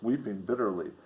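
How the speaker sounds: usual speaking voice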